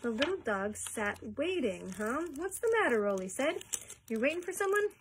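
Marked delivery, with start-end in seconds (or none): 2.4-3.0 much higher, louder mock concern; high and playful. Rolly playfully asks the dog. 4.1-4.9 much higher, louder mock concern; high and playful. Rolly playfully asks the dog.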